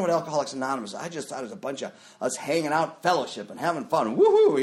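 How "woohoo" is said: high, loud woohoo; mock party whoop. Recovering drinker mimicking fun at fellowship, mock party whoop. Mimes the group having fun.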